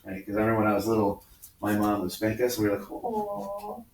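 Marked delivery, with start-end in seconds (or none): 2.9-3.8 high-pitched mock whimper; put-on tearful apology; fake cry. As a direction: pretend to cry like a scolded kid. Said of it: "fake cry".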